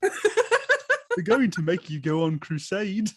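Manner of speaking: slow butler voice, laughing through it; Michael Caine impression. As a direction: play the loyal butler warning his master, slow. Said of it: "sounds like a deeper voiced Michael Caine".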